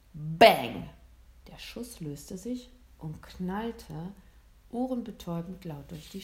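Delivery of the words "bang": explosive, falling exclamation; loud gunshot word. A narrator's reading. Narrator voices the hunter's rifle firing, explosive. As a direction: burst it out like a shot.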